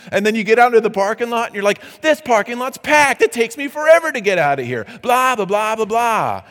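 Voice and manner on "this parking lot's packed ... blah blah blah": high, loud, fast whiny griping; mock grumbling. Churchgoer stuck in the parking lot, mock grumbling. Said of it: "And you're grumbling".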